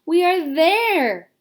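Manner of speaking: put-on old grasshopper putting on cheer; cheering up his frightened friends; cheerful old grasshopper. A narrator's reading. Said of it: "trying to be cheerful".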